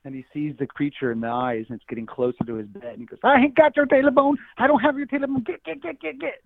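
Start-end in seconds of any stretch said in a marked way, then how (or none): 3.2-6.4 high, loud, panicked shooing; scared hermit yelling at a monster; frightened old man. Acted.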